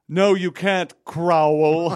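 high, loud sitcom caricature; loud, high-pitched mock refusal; Carol Kane. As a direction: blare it as a hammed-up impression. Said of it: "And she goes".

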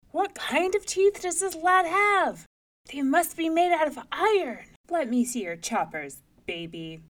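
high, loud disbelief; astonished giant. A narrator's reading. Giant marvelling at the baby's teeth, astonished. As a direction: exclaim loudly, aghast.

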